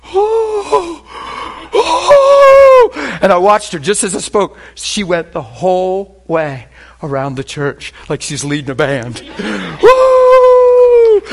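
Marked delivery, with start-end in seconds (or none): 0.0-3.2 imitating her rising, loud whoops; high whooping "oh". Imitates healed woman marching off. 9.8-11.2 long, piercing imitated "woo"; loud high whoop.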